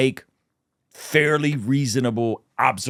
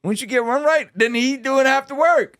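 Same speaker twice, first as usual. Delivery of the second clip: high playful voice; 14 semitones higher than usual. Shown as a demonstration. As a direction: say it high and stretched. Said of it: "repeats it in high voice".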